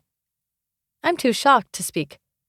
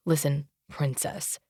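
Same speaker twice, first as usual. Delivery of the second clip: low, soft, mocking weight on "princess"; stress on princess; 6 semitones lower, 7 dB quieter than usual. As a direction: lean scornfully on "princess". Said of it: "he emphasizes the last word".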